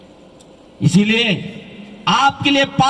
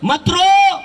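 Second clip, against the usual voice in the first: stern command; 6 semitones higher, much slower than usual. Retold or imitated. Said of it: "ध्यान से सुनो".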